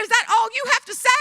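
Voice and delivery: high, loud, fast exasperated demand; wife snapping at husband getting into bed, loud and high; frustrated outburst. Retold or imitated. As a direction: fire it at him, exasperated. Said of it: "I was so frustrated".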